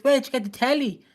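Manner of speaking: imitating Bianca. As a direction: voice her like a suspicious girlfriend. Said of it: "Bianca's like".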